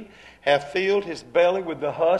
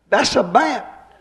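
high, loud, slow alarm; suddenly see what it is; startled realization; 5 semitones higher, 6 dB louder, slower than usual. Retold or imitated.

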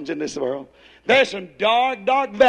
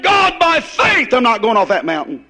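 shouted faith command; 5 dB louder than usual. Retold or imitated. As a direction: shout it at the skid like you mean it. Commanding God on sliding mountain.

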